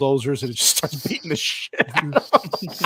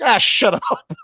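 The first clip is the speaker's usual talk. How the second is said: higher, louder dismissive snap; mock brush-off; 9 semitones higher, 6 dB louder, much slower than usual. Friend acting Batman telling Superman off, loud and high. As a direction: bark it off mid-laugh.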